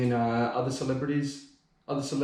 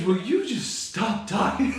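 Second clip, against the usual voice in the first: higher, slow, put-on old-man plea; Joe Biden impression; 9 semitones higher, slower than usual. Mocking Biden's debate line to his friend, higher and slow. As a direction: mimic Biden begging him to stop. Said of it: "He goes".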